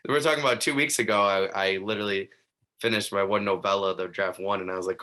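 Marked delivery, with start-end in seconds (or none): 0.1-1.2 talking through a laugh, a bit higher and quick. Real, not acted. Host tells the guest her cover search, laughing. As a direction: let the laugh spill into the words. Laughing while starting a story.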